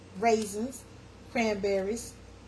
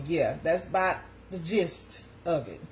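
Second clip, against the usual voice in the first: ordinary delivery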